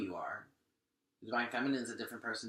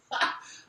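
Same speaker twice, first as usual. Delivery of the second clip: breathy laugh. Real, not acted. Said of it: "I shouldn't be laughing".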